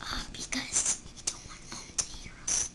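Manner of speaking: hushed secret whisper. Real, not acted. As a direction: whisper so Mom can't hear. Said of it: "because I started whispering".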